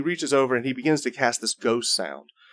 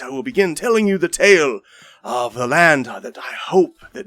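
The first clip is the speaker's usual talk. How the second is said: grand showman bard voice, pitched up; theatrical bard; 4 semitones higher than usual. Acted. Bard Marcus welcoming the tavern crowd to his tale, grandly theatrical. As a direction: welcome the crowd like a flamboyant storyteller.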